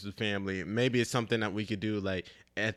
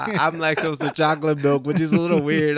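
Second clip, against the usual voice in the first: laughing; 5 semitones higher, 9 dB louder than usual. Real, not acted. Giggling through a joke.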